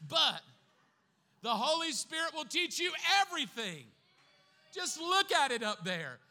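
loud, high preaching shout; fiery revival preacher; preaching at full force. Real, not acted. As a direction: lift the whole room with it.

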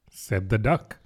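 plain reading voice

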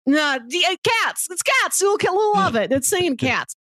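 ordinary talk

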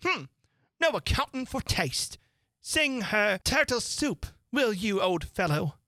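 sniff, offended and haughty. Acted. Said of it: "in a rather offended tone".

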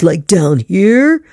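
slow, pulled down from the chest; resonating from the chest; chest voice demo. Shown as a demonstration. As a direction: drop it into your chest to show where it lives. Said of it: "is it coming from the chest".

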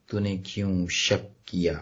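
low, slow, solemn question; grave questioning. Retold or imitated. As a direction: ask it gravely, drawn out and low. یسوع کا سوال سناتا ہے. (سنجیدہ، دھیما سوال)

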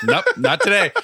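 high, loud mock refusal with a laugh; imitating himself refusing the blame. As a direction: bat them away playfully.

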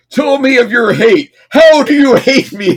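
high, loud, theatrical alien demand; mock the alien's grand booming question; hammed-up cartoon god voice; hammy villain. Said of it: "he's like".

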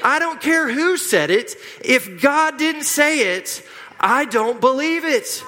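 high-pitched, slowing emphatic declaration; like you mean it. Real, not acted. Preacher telling church what not to believe, like he means it. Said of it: "like you mean it".

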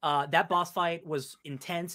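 ordinary talk

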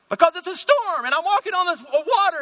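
high-pitched, frantic protest; exasperated panic. Acted. Peter answering Jesus on the water, high protest.

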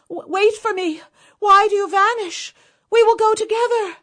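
high, loud desperate cry. Acted. Wife crying out to her vanishing husband, high desperate shout. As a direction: call after him as he vanishes. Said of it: "She cried out".

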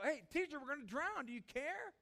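loud, high-pitched cry for help; desperate plea. Acted. Disciples wake their teacher as they drown, desperate shout. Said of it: "the desperation of".

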